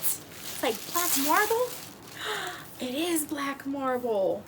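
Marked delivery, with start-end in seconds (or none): 1.7-2.7 surprised gasp. Real, not acted.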